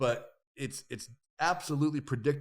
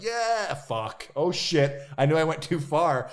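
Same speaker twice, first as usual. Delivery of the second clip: retold yell; 4 semitones higher, 7 dB louder, slower than usual. Yelling during the cliff jump.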